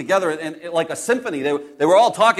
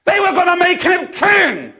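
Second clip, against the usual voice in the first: exasperated shout; 10 semitones higher than usual. Acted. Disciple protesting Jesus leaving the crowd, exasperated shout. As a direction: shout it in disbelief, pitched high.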